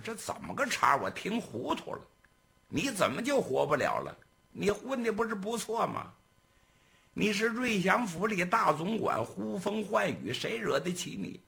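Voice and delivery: high, incredulous questioning; puzzled boss pressing for the story; gang boss. Acted. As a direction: boss baffled by the servant's plight, incredulous.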